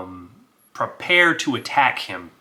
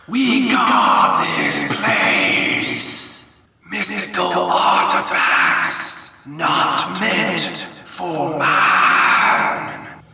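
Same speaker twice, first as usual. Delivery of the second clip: slow whispered echoing spirit voice; a ghostly guardian warning off intruders; eerie echoey spirit; 6 semitones higher, 4 dB louder, much slower than usual. Acted. Will-O-Wisp warns Frostbite in his head, slow eerie echo. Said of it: "crazy, echoey voice that is in his head".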